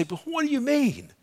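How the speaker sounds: high-pitched bewildered objection; squeaky mock question; mock protest. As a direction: imitate a listener protesting in a high voice. Mimics a listener's protest.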